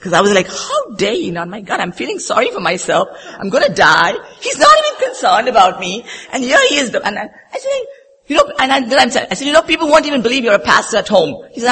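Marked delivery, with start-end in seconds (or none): 0.5-6.9 high-pitched retold indignation; upset. Wife complaining about her unconcerned husband, hammed-up upset. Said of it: "I was so upset with him". 9.5-11.5 fast, high retold jab; indignant retort. Wife scolding her pastor husband, quick indignant retort.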